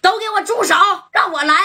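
high shouted command; a hoodlum bursting in, shouting to take over. Retold or imitated. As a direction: yell from behind to stop everyone. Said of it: "后边有人喊了".

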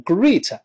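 great mispronounced with an ee vowel, like greet; common learner mispronunciation. Shown as a demonstration. Teacher imitating Chinese learners' mistake, great with an ee sound. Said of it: "中国人容易把它读成".

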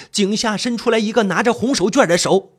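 ordinary delivery

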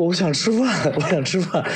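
带哭腔高声喊出来; 边哭边大声说我想吃饭; 哭喊着饿. Retold or imitated. 哭着对朋友喊饿. (哭着喊饿)

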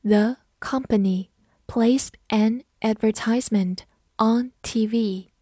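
slow, phrase by phrase; slow reading. Shown as a demonstration. Teacher slowly modelling the TV advertisement sentence, slow reading. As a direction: read it out slowly with pauses between chunks. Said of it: "好，慢读一遍".

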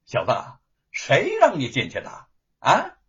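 loud rebuke, pitch jumping up at the end; scolding shout. Acted. Gatekeeper scolding the young man, loud rebuke. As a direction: bark at the intruder. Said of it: "一个家庭呵斥道".

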